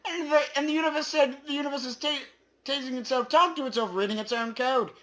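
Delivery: high, loud, squeaky proclamation; squeaky universe voice. Acted. Voicing the universe to the player as a loud chipmunk.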